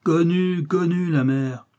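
dismissive interruption. A narrator's reading. Chief cutting off the woman's protest, dismissive. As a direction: cut in loudly with weary scorn.

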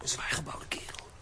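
hushed whisper to a partner; tense stakeout whisper. Acted. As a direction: whisper so the crook won't hear. Whispering while lying in wait.

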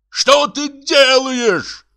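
slow, very high-pitched yell; theatrical aghast shriek; outraged yell. A narrator's reading. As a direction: stretch the words in a horrified high cry. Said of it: "заорал Бутронце".

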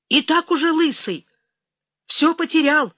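high-pitched, voiced complaint; muttering turned loud and high; agitated grumbling. A narrator's reading. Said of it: "забормотал ракетчик".

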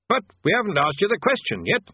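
high, nervous stammering wizard; flustered bursar. A narrator's reading. Bursar voiced.

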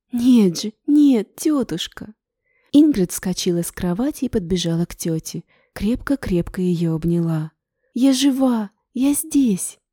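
excited. Acted. Ingrid insists she is alive.

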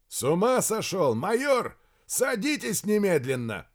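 high-pitched, indignant shouting; outraged order. A narrator's reading. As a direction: order him aboard in outrage. Colonel shouts order at major. (возмущённый приказ криком)

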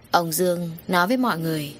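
ordinary delivery, nothing marked

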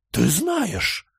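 high surprised exclamation; exclaiming. A narrator's reading. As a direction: blurt it out in astonishment. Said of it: "воскликнула Большая Барбара".

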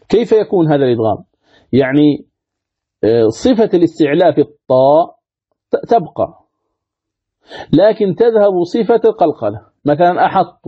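usual speaking voice

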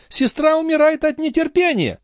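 high-pitched, impatient call; excited high-voiced summons. A narrator's reading. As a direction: hurry him along from afar. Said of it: "крикнула ему Шон".

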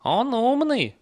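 very high horse voice; horse praising rider. A narrator's reading. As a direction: pitch it way up, proud of the rider. (очень высокий голос лошади)

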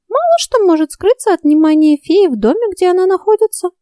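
high, evasive little fairy. A narrator's reading. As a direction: dodge the question as a fairy. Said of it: "уклончиво проговорила Ульрика".

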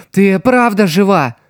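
ordinary delivery

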